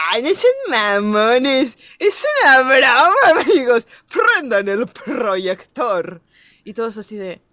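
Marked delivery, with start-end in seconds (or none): 0.1-6.2 louder, slow, laughing mock shout at the class; mock teasing shout. Teasing classmates she thinks are faking, mock shout. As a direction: yell it as if the teacher joke. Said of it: "y yo así de".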